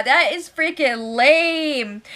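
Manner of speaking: slow, drawn-out scorn; scornful verdict. Real, not acted. Vlogger judging the dinner excuse, slow scorn. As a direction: drag it out with contempt.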